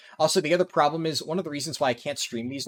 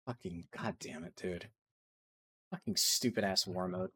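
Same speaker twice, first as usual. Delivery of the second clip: much softer, lower grumble; muttered curse; 7 semitones lower, 10 dB quieter than usual. Real, not acted. Annoyed gamer muttering at war mode. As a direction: grumble it under your breath.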